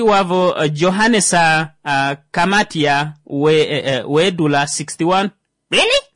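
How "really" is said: leaping high, incredulous really; sudden disbelief at the age; shocked rising Really, much higher. Real, not acted. Shocked by age sixty-one.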